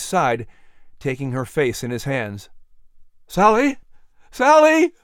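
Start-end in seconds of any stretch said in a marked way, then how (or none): 3.3-3.8 high, loud, alarmed call; panicked cry. A narrator's reading. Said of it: "cried in a panicked voice". 4.3-4.9 very high, loud cry of panic; panicked cry. A narrator's reading. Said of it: "cried in a panicked voice".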